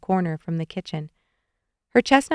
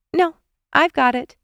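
high call to another room; 8 semitones higher, 3 dB louder than usual. A narrator's reading. Nicole in the kitchen answers Carl, calling out. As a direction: call it back, pitched high. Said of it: "she called".

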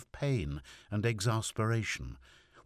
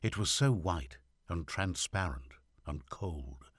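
ordinary delivery

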